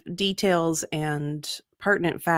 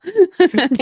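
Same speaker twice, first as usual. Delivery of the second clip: loud laugh; 12 semitones higher, 10 dB louder than usual. Real, not acted. Laughing at the gunslinger joke, loud high laugh.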